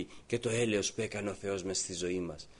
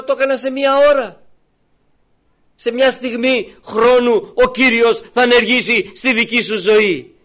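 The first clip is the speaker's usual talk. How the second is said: loud, high, impassioned sermon voice; fervent preaching; 14 semitones higher, 16 dB louder than usual. Acted. Preacher to congregation, voicing Mary and Jesus, loud and fervent. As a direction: preach it out to the whole church.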